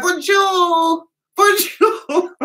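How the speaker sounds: high squeaky bonjour, waving; excited wrong goodbye. Retold or imitated. Tourist saying bonjour instead of goodbye, high and excited. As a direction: blurt bonjour brightly as you leave. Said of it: "So I was like".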